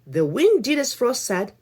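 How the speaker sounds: clear, lively storybook reading; story narration. A narrator's reading. Reading a folktale to children, lively storybook voice. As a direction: read the folktale to little listeners.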